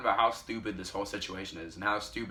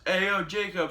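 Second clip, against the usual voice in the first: higher-pitched imitation of calling out; mock distant yell; 7 semitones higher than usual. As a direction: call to him as if from up the road, half-shouted. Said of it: "Carter yells".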